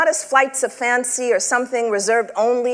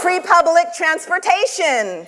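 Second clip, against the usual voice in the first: higher, punchy prompt; emphatic call-out; 6 semitones higher than usual. Acted. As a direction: throw it out to the room. Prompting audience call and response.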